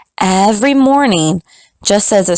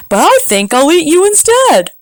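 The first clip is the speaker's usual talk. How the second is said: roadrunner voice; 8 semitones higher than usual. Acted. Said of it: "said the roadrunner".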